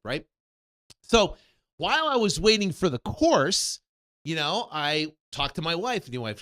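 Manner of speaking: ordinary talk, nothing marked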